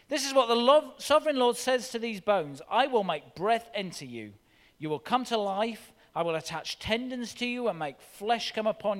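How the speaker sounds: loud, ringing proclamation to the dry bones; preaching God's words. Acted. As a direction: thunder it out like a prophet. Proclaims God's words at full force.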